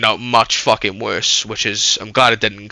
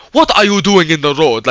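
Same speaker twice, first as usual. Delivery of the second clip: higher-pitched mock African accent, demanding an answer; 5 semitones higher, 4 dB louder than usual. Driver demanding to know why a pedestrian is in the road, African accent. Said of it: "that's how he sounded".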